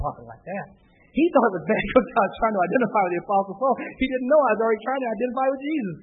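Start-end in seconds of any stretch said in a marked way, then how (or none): none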